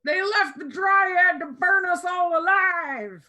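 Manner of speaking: high, slow, loud accusing yell; angry shout. Acted. Villager shouting blame at the tieflings, angry. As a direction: bellow it at the crowd. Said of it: "you hear a voice go".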